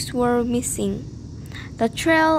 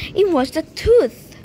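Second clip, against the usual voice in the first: loud protest; 6 semitones higher, 7 dB louder than usual. Acted. Protesting to principal.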